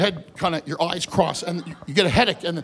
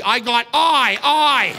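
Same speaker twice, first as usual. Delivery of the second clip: strained cry; 4 semitones higher, 7 dB louder, much slower than usual. Acted. Evil eye attack.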